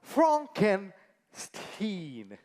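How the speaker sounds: "Frankenstein" very slowly as FRONK-en, pause, STEEN; "Frankenstein" in separated, deliberate parts ending in a long "steen"; corrective pronunciation. Acted. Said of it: "My name is pronounced Frankenstein".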